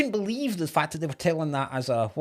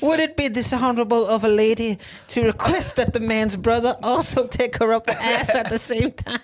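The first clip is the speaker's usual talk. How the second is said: mock southern lady; 6 semitones higher than usual.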